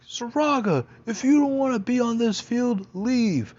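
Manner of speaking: high, loud mock coach bark; angry coach. Coach ordering the late player off the field, high loud bark. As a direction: bark at him like a fed-up coach. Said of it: "he's like".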